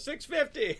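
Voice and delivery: high, quick call-out; eager bidder. Retold or imitated. Bidder calling out a bid, excited. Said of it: "Someone goes".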